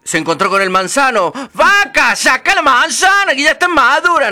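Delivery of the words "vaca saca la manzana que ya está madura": high, loud apple-tree voice; a fairy-tale tree calling for help; talking apple tree. Acted. The apple tree asking the girl to pick its apples, high and loud.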